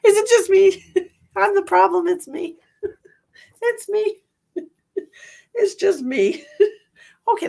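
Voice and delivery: high, loud giggly self-mockery; playful self-teasing giggle; laughing at herself. Real, not acted. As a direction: laugh through the words.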